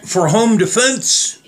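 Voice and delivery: usual speaking voice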